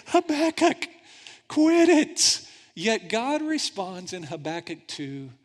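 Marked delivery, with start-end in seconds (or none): 0.1-2.4 very high, loud panicked plea; frantic hush. Acted. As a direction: beg him to stop, alarmed. Friends hushing Habakkuk.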